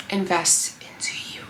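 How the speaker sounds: near-whispered, earnest urging; hushed plea. Real, not acted. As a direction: drop to a soft, breath-only appeal. Intimate plea to viewer.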